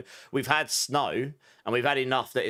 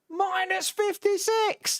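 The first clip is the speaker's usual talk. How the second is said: shocked squeal; much slower than usual. Real, not acted. Shocked at extreme cold.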